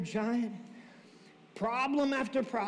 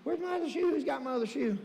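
ordinary talk

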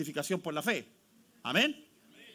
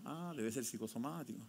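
mock gullible sigh; 6 semitones lower, 12 dB quieter than usual. Mocking a gullible believer.

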